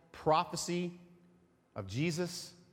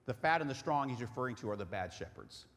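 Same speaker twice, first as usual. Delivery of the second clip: ordinary delivery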